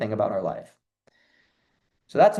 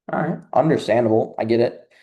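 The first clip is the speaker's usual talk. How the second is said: usual speaking voice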